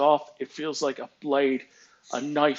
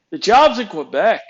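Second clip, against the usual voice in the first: loud, higher imitated correction; emphatic retort; 5 semitones higher, 9 dB louder than usual. Engineer reminding boss where the job is, loud retort. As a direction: state the obvious loudly.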